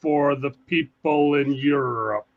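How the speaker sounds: very slow, drawn-out words for listeners abroad; deliberately slow. Shown as a demonstration. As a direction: stretch each word out so foreigners can follow. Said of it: "I will talk slower".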